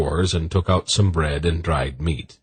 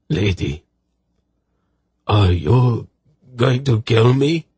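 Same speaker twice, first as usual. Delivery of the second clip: halting question, rising higher at the end; fearful; 5 semitones higher, 3 dB louder than usual. A narrator's reading. Soldier asking the lady if she'll kill him, fearful. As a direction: ask timidly, climbing in fear. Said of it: "fearfully stared at Zenedra".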